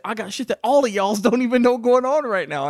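very high, very loud mock boast; worked-up quote. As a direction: belt it out as an imitated brag. Quoting Diego's boast from the show.

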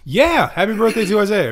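loud, high birthday shout-out; excited cheer. Real, not acted. Podcast host wishing the co-host happy birthday, excited cheer. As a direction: call it out loud and high, celebrating.

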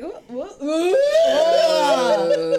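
drawn-out, wordless cry of revulsion; acted disgusted retching. Woman reenacting the first time she smelled it, mock disgust. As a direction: stretch out a mock gagging wail.

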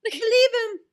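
high, loud fangirl outburst; real excited squeal of belief; gushing insistence. Real, not acted. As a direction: burst out with smitten conviction. Gushing over a character.

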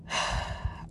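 long unvoiced sigh; tired sigh. A narrator's reading. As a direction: let out a heavy breath. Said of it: "I sighed".

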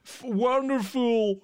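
put-on high, slow posh English accent; hammed-up English accent; mock English accent. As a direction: show off an exaggerated English accent. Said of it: "quel anglais parfait, quel accent".

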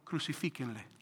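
quiet, menacing repeat of the crowd's cry; a muted echo of a crowd calling for crucifixion; hushed mock crowd cry. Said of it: "estaba gritando".

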